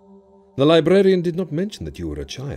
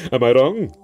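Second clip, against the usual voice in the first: high laugh, then a louder question; laughing cry; 4 semitones higher, 4 dB louder than usual. Acted. Djinn slapping Frank's shoulder, crying out with laughter. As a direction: crow it through laughter as the djinn. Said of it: "he cried, laughing and slapping me".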